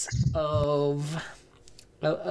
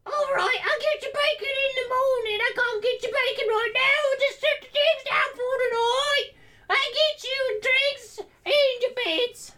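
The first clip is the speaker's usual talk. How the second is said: tavern lady voice. Acted. Tavern lady denies bacon.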